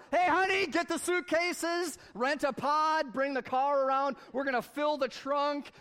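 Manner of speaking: excitedly calling the spouse to grab suitcases. Pretend. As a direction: shout across the yard to your honey. Calling spouse, money tree.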